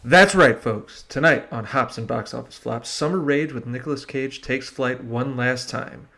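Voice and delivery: hosting in a hammed-up Southern accent. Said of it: "the last of my Southern accent".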